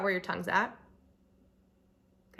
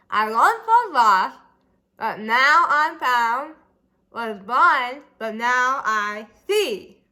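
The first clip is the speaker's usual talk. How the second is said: slow and loud, tongue hanging out; tongue sticking out; 9 dB louder, much slower than usual. Shown as a demonstration. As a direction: mangle the words with tongue out. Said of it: "speak the words to the song with your tongue stuck out".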